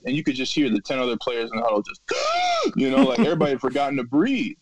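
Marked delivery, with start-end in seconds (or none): 2.1-2.7 sharp, high inhaled gasp; imitated gasp. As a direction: gasp like a whole huddle remembering to breathe. Huddle players catching their breath.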